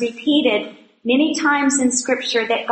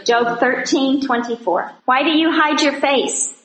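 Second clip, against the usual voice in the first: ordinary talk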